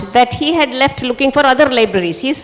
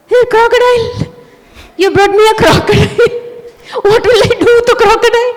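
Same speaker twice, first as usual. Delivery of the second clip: high, louder, laughing excitement; thrilled disbelief; 10 semitones higher, 4 dB louder, faster than usual. Acted. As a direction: squeal it out, laughing, too excited to believe it. Boy receives a real crocodile.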